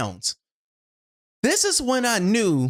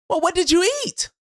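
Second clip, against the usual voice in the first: imagined questioner; 12 semitones higher, faster than usual. Pretend. Voicing an imagined listener.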